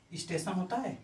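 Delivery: usual speaking voice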